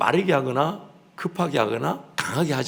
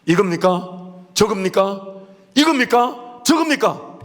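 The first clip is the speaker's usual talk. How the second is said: rising higher and louder with each question; exasperated pressing; 9 semitones higher, 6 dB louder, slower than usual. Retold or imitated. Preacher pressing a silent elder, building higher and louder. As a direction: badger him with growing impatience. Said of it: "그럼 막 전 미칩니다".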